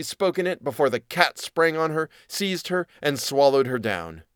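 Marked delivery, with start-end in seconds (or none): none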